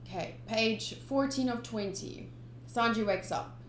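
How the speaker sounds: plain reading voice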